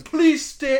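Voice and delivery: high, loud mock shout; retold protest shout. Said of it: "basically what he was shouting".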